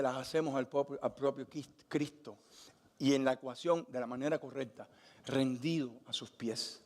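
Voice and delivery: soft, reverent preaching; hushed preaching. Acted.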